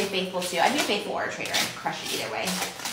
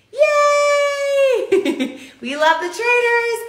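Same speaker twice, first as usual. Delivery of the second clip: thrilled cheer; 13 semitones higher, 5 dB louder, much slower than usual. Real, not acted. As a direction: squeal of delight, very high and loud. Opening the PR box, thrilled.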